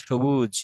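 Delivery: সবুজ with everyday Bengali 'sh'. Shown as a demonstration. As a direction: say সবুজ as 'shobuj'. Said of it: "বাংলায় যেরকম উচ্চারণ করা হয়". (বাংলা উচ্চারণে সবুজ)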